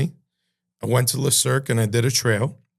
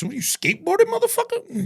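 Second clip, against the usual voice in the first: high, squeaky disbelief rising on motherfucker; retelling his own stunned comeback; incredulous. Retold or imitated. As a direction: act baffled and mocking at once. Mocking the unknown word ramps.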